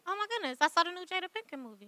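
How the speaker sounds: very soft acted chit-chat; playing innocent. Girlfriend tells boyfriend about the movie, hushed and innocent. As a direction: gush innocently under your breath.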